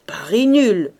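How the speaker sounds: forceful objection called out; loud protest. A narrator's reading. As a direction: declare it void over the uproar. Gentleman protesting the bet. (objection lancée d'une voix forte)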